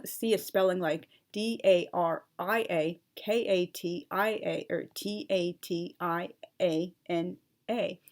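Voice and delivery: ordinary talk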